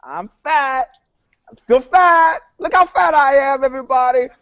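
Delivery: high, loud hammed-up announcement of being fat; over-the-top mock celebrity statement; mock press conference confession. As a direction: belt it out like a proud public confession. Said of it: "hold the press conference and go".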